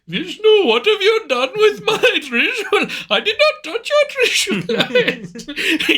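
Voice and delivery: high-pitched, hammed-up Indian accent; mock argument in an exaggerated high Indian accent. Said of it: "Do you think I should try to do an Indian accent".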